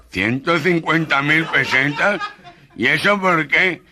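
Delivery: slow, high astonished cry, laughing; outraged disbelief. Acted. Gangoso asking the shopkeeper about the parrot's price, aghast. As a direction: stretch out the shock at the price.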